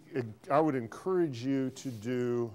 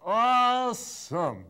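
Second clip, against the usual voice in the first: high, loud, long-drawn "awesome"; elated, slow and loud congratulation; delighted praise; 9 semitones higher, 7 dB louder, much slower than usual. Real, not acted. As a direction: celebrate it with a big stretched-out cheer. Teacher cheers student's corrected answer.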